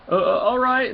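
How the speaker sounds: high, nervous stammered okay; flustered reply. Acted. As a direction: squeak it out, caught off guard. Flustered helper answers.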